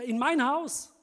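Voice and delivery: high, loud startled cry; shocked shout. Acted. Man shouts on learning God is coming, loud. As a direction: shout it in disbelief. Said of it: "schrie er".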